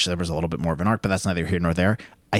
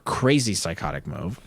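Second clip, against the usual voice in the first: ordinary talk, nothing marked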